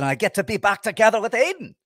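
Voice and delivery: high, put-on Scottish lilt; quick Scottish impression of the actor; Ewan McGregor accent. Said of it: "That's a decent a-, accent".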